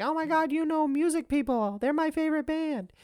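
put-on very high-pitched gushing young fan; excited little cousin. Little cousin thrilled about the band, high excited voice. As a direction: imitate a starstruck kid in a squeaky high voice. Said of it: "Her, like, little cousin who was".

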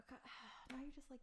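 exasperated whispered sigh; whispering. Real, not acted. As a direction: breathe it out under your breath. Sighing aside, whispered.

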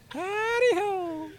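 high, slow goofy howdy-ho; silly greeting voice. Pretend. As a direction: sing out a goofy hello. Surprise guest greeting, silly voice.

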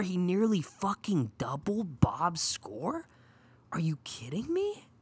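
unvoiced whisper of disbelief; incredulous whispered aside; stunned whisper. Real, not acted. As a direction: breathe it out, can't believe it. Disbelief at Drew's huge score.

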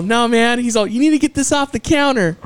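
high, anxious mock clerk; nervous cashier. Drive-thru worker refusing the bottle, high nervous mock voice. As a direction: squeak out the refusal.

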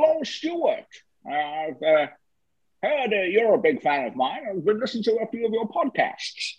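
high, put-on Scottish Connery voice; Scottish Connery voice greeting Stuart; Sean Connery impression. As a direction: do your best Sean Connery for a fan. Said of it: "So here's Sean Connery".

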